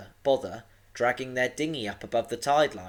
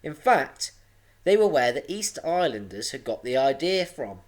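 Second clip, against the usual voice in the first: usual speaking voice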